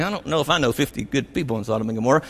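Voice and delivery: Abraham privately doubting, quiet and hurried; muttering to himself. Retold or imitated. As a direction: mumble the doubt under your breath, quick.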